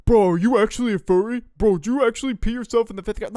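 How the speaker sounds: high, loud, fast mocking classmates; loud, rushed schoolkids badgering Michael; pestering classmates. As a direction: rattle off the nosy questions loud and quick.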